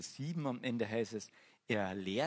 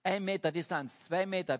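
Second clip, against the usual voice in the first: loud, higher calls; calling out; 6 semitones higher, 5 dB louder than usual. Retold or imitated. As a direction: bark the distances across the market like a manager. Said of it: "hat die ganze Zeit gerufen".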